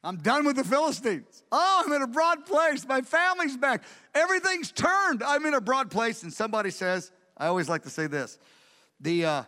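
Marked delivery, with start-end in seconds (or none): 0.0-6.2 high, exultant cry of deliverance; exultant declaration. Acted. David rejoices at being free of the Philistines, exultant. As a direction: proclaim it with rising jubilation.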